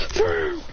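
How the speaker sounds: high-pitched "vroom" effect; imitated whoosh. Imitates light flaring out of water.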